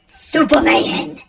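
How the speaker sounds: pumped patient shouting on the go juice; energized shout. Retold or imitated. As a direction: bellow it like a hero taking off. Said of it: "I was like".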